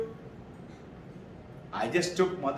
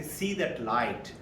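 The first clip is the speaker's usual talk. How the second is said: calm guidance; 6 semitones lower, 5 dB quieter, much slower than usual. Shown as a demonstration. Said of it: "I say".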